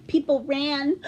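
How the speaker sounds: plain reading voice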